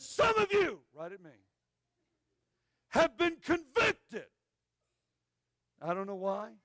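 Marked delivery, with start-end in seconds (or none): 0.0-0.8 high, loud, drawn-out preacher call; booming preacher. Retold or imitated. Evangelist preaching to the crowd in a booming voice. Preacher quoted pointing at him. 2.9-4.3 high, loud, slow preacher call; booming preacher. Retold or imitated. Evangelist pointing at the boy in the choir, booming and slow.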